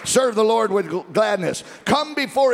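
high, shouted revival preaching; full-force preaching. Real, not acted.

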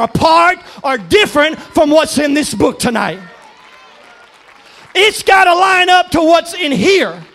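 loud, high, driving sermon shout; full-force preaching. Acted. Preacher defending the Word of God to his church, full-force preaching. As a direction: preach it hard, punching each alternative.

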